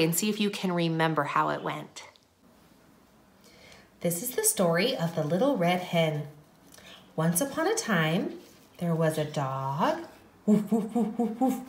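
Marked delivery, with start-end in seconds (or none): 4.0-10.0 soft, hushed storytelling; story narration. A narrator's reading. Teacher opens the Little Red Hen story, soft narration. 10.4-11.6 soft little woofs; dog barking. Acted. Storyteller introduces the dog with soft imitated barks.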